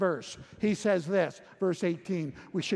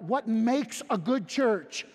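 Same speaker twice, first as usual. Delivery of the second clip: normal talking voice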